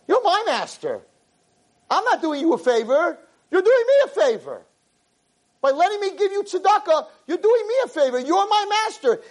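high, pleading humble voice; high humble plea. Acted. Avraham's humble speech voiced.

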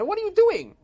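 high, loud outraged shout; upset. Acted. Crowd confronts old man eating on fast day, upset shout. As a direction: yell it in shocked outrage. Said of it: "they were all upset".

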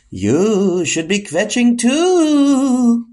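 high, slow, drawn-out spooky voice; eerie high-pitched suggestion, stretched out. Acted. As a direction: coax like a hypnotic phantom, slow and high. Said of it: "not in that spooky voice".

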